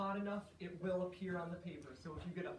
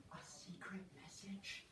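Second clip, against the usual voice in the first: whispering; 10 dB quieter, slower than usual. Acted. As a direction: whisper "a secret message" unvoiced. Secret message, said as secret.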